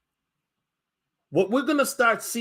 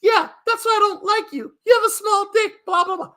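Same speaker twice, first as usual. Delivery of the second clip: high, loud mocking woman's rant; 13 semitones higher, 4 dB louder than usual. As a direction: mimic a shrill girlfriend insulting him. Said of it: "she might say some off-the-wall shit like".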